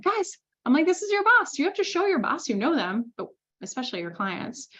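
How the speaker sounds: high, emphatic teasing lecture; mock-chiding the team; telling the team to know their boss, high teasing scold; playful scolding.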